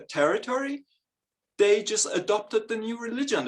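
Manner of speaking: ordinary delivery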